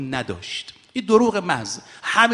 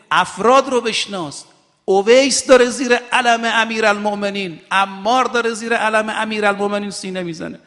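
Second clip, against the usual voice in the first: high, raised preacher's exhortation; impassioned sermon voice; fiery preaching; 5 semitones higher than usual. Acted. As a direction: rouse the crowd from the pulpit. Preaching climax to the audience.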